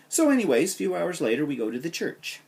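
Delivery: normal talking voice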